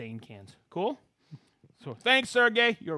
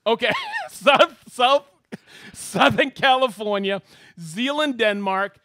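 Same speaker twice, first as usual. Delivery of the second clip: loud, high announcer shout-outs; excited host roll call; 7 semitones higher, 12 dB louder, slower than usual. Acted. Host hailing viewers watching from around the world, excited roll call. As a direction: call out each place like a rowdy emcee.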